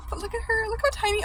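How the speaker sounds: high gushing squeal; overwhelmed by cuteness. Real, not acted. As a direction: coo over something adorable. Gushing over tiny snake.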